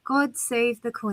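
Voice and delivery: slow British accent, softly drawn out; measured British lilt. Shown as a demonstration. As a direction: stretch each word in a British accent. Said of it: "You're getting very good".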